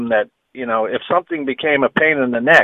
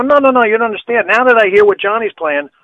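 higher-pitched urgent protest; mock-urgent, pitched-up explaining; retold insistence; 8 semitones higher, 4 dB louder than usual. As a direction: act out pleading your case over objections.

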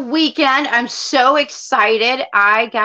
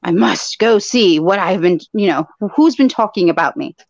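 mock-solemn summons; 4 semitones lower than usual. Host imagines the absent Podfather being summoned, mock-solemn. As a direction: intone it like someone mysteriously summoned.

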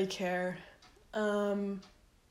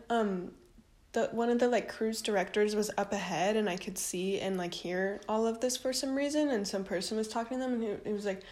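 normal talking voice

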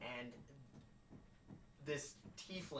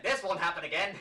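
loud, higher-pitched acted agitation; frantic wizard; 5 semitones higher, 11 dB louder than usual. Wizard frantically casts protections after a breach, agitated. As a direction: raise the voice as a flustered wizard. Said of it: "frantically runs around and starts".

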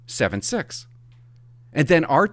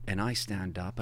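ordinary talk

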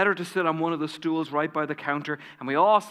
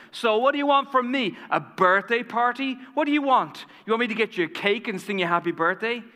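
sharp, higher mocking retort to a friend; nasty tone; 6 semitones higher than usual. Friend snapping at the woman's birthday news, nasty. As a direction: sneer at her like she's asking too much. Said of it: "responded in a nasty tone".

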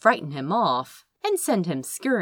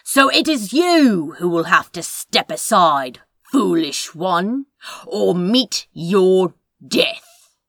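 louder, slowing dark-wizard threat; menacing sorcerer; 6 dB louder than usual. Acted. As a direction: threaten him grandly, dragging out the end. Villain threatens the hero.

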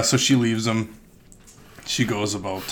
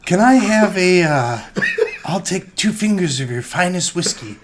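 mock lady voice; 9 semitones higher, 6 dB louder than usual. Lady orders whiskey.

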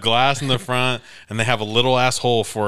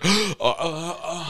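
stammering cousins; 7 semitones higher, much slower than usual. Retold or imitated. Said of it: "They're, gasps".

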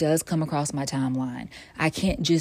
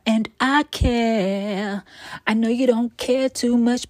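slow, higher and louder "And I care"; sassy emphasis; 7 semitones higher, 3 dB louder, slower than usual. Real, not acted. Bragging to listeners about weight loss, sassy and high. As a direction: stretch it out, playfully insisting.

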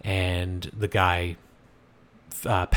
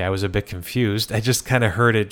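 ordinary talk

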